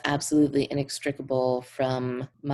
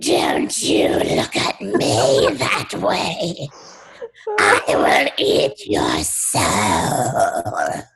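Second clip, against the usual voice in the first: slow, whispered witchy menace; wicked witch voice; 5 semitones lower, 8 dB louder, much slower than usual. Acted. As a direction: growl it like an ancient witch. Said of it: "mine is like a wicked witch voice".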